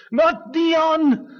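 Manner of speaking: usual speaking voice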